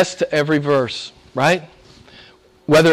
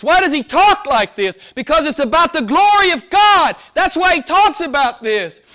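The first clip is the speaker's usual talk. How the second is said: much higher, ringing sermon climax; full-force preaching; 4 dB louder than usual. Acted. Pastor exhorting the congregation on God's glory, full-force preaching. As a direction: preach it out at the top of your voice.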